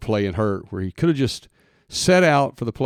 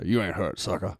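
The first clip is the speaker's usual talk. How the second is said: lightly imitated coach, trailing softer; old coach; 2 semitones lower, 5 dB quieter than usual. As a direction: recall the coach's put-down under your voice. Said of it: "I could just hear it over in my voice".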